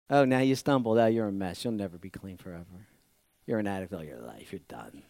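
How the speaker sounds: low, fading sneer down to nothing; the enemy taunting the fallen; sneering accuser. Acted.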